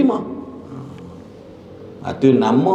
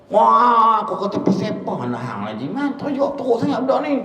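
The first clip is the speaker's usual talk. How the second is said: high mock ustaz; 11 semitones higher than usual. Ustaz questions the father.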